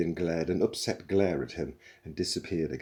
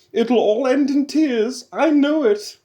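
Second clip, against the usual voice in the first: much higher, much louder, slow shout; matriarchal shout; 13 dB louder than usual. A narrator's reading. Computer shouting after the crew, loud high fretting call. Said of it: "shouted Eddie after them".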